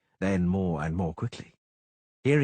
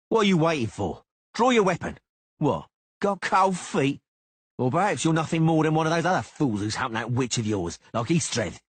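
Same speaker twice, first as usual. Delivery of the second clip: higher, louder jeering taunt; angry taunt; 8 semitones higher, 5 dB louder than usual. A narrator's reading. Cicada taunting Geralt to draw, higher and louder. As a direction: sneer to provoke a draw.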